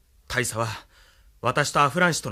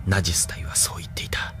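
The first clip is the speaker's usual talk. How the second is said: low, softer whisper to himself; lower, softer inner whisper; whispered brooding; 7 semitones lower, 4 dB quieter than usual. Acted. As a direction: mull it over under his breath. Brooding inner monologue alone.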